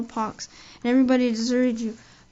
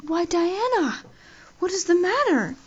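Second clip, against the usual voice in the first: higher, startled concern; alarmed; 7 semitones higher, 4 dB louder than usual. Acted. Anne startled as her friend stands unsteadily, higher alarmed. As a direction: ask in sudden worried alarm.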